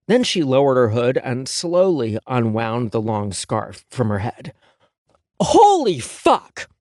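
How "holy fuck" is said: high, slow, loud shocked curse; loud exclamation. A narrator's reading. Stylist seeing the ruined hair, loud shocked outburst. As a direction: blurt it out in horror. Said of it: "my exclamation was loud enough".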